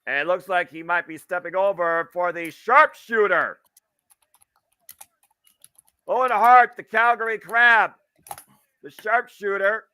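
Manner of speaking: high, loud building hype call; wrestling commentator. Real, not acted. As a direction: build the crowd up to the hold. Live play-by-play.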